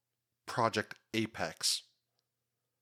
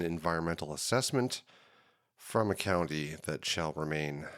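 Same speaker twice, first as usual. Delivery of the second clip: hard-boiled detective voice-over, measured and moody; noir narration; 3 semitones lower than usual. Acted. Podcast host opening his cold case like a noir detective. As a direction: tell it like a tired private eye.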